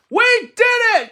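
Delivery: high, loud burst of joy; excited cry. Acted. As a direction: cry out in triumph. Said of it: "cried Toad".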